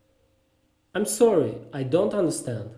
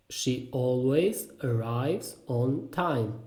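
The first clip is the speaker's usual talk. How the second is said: very slow, word by word, softer; slow repeat; 2 semitones lower, 4 dB quieter, slower than usual. Shown as a demonstration. Teacher repeats a sentence about punctuality, slowly and separately. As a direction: space out each word for learners. Phrase drill, slower repeat.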